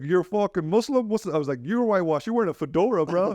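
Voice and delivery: high teasing jabs; mock roasting.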